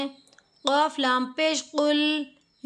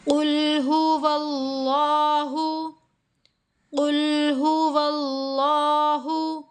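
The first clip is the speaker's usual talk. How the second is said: slow, drawn-out chanted recitation; slow Quran recitation; 4 dB louder, much slower than usual. Shown as a demonstration. Quran teacher demonstrating a verse, slow chanted recitation. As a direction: recite it slowly, drawing out each vowel. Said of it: "यहाँ पर खड़ी ज़बर है".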